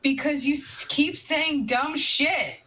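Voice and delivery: drawn-out scolding, word by word; slow scolding. Acted. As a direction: spell it out slowly. Scolding a teammate.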